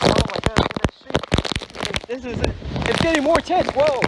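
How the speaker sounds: loud high cry in the storm; shouting over wind. Real, not acted. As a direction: yell against the wind. Storm intensifying.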